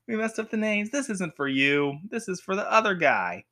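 put-on cheesy sitcom delivery; sitcom reveal. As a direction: announce a silly mix-up, hammed-up. Acted sitcom twist, continued.

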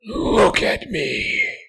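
very high, loud, slow monstrous order; harsh demanding command. Acted. Creature losing patience, harsh demanding order. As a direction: snap the command at him. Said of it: "it was harsh, demanding".

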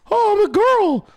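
high, loud falsetto; mock girly voice. Shown as a demonstration. Showing the worker how easy it is, mock girly falsetto. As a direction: demonstrate an easy, hammed-up girl voice. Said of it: "See, it's fucking easy".

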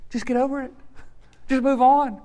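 mock someone waving away the hurt; dismissive. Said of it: "and you're like".

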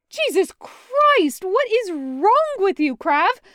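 high, loud, panicked cry, slow on the first words; half-shrieking in disbelief; frantic shout at a wounded friend; hysterical. Acted. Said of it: "he asks hysterically".

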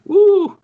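high, loud "woo"; piercing little whoop of excitement; excited whoop. Real, not acted. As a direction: let out a sudden high cheer. Player cheers before rolling the save.

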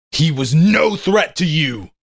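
shouting. Acted. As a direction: shout it at his face. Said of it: "I shouted".

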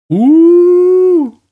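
long, loud, high-pitched "ooh"; mock spooky ooh. Preacher making fun of demon rituals, mock spooky wail.

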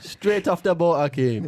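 high, loud Jamaican impression; playful Jamaican patois imitation; mock Jamaican accent. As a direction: put on a hammed-up Jamaican accent. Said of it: "he still sounds like he only came to yesterday".

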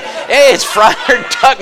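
a teasing yell across the crowd; mock shout. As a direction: call it out like spotting a celebrity. Mock-shouting at passing priests.

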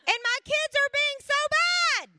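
high, loud comic wail; exasperated, high-pitched complaint; exasperated outburst. Retold or imitated. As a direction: let frustration burst out loud and high.

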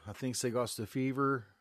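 put-on almost-Canadian accent. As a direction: quote the film line with a slight Canadian lilt. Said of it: "her accent cracked me up".

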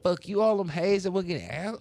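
loud, high cussing-out; hammed-up angry outburst, pitched high; mock angry rant. Man voicing texts he sent to women, mock angry rant. Acts out his angry text messages.

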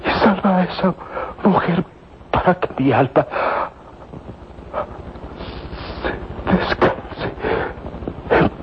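slow fading words, sinking into a whisper; dying breath. Acted. Dying man gasps out his last confession. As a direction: let the life drain out with each word.

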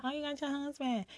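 模仿别人起哄的语气; mock voice of others. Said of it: "everybody be wanting to".

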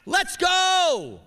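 high, loud hammed-up road rage; imitating frustrated fury. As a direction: shout it at the old lady ahead. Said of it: "get frustrated and furious because he's like".

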